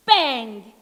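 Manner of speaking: loud spoken gunshot; mock gunshot. Narrator voicing the pistol shot, loud. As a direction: shout the bang like a pistol.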